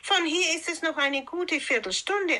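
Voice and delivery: put-on guileless child's answer, pitched high. A narrator's reading. Rotkäppchen telling the wolf the way, guileless. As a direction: answer openly, suspecting nothing. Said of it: "antwortete Rotkäppchen arglos".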